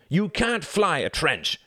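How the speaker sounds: high, drawn-out objection; indignant protest. A narrator's reading. Reginald disputes his brother's trench flight, indignant protest. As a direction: stretch it out in high-pitched outrage.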